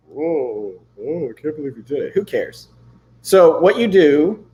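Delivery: usual speaking voice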